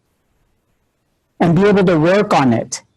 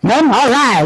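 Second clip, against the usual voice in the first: normal talking voice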